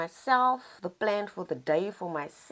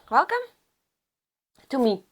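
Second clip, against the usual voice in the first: high, rising, louder welcome; bright welcome; 7 semitones higher, 6 dB louder, faster than usual. Shown as a demonstration. Greeting her new audience, a high rising welcome. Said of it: "Let's start there".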